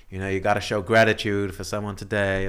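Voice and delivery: imitating an American yoga teacher's accent; awful American accent. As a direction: put on a bad American accent to open a yoga class. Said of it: "That was my awful American accent".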